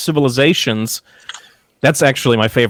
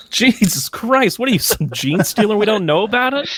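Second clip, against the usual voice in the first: much higher, stretched-out disbelief; mock disbelief; 10 semitones higher than usual. Real, not acted. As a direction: sound amazed and teasing. Teasing disbelief at metal chewing.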